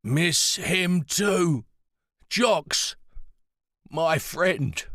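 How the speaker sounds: put-on confused old warrior praising her; frail old man. A narrator's reading. Pritch voiced by narrator.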